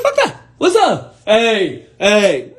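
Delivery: high, whooping call to the room; loud greeting. Acted. Greeting a whispering room, loud and brash. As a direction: burst out with loud hellos. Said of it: "get loud in a quiet room".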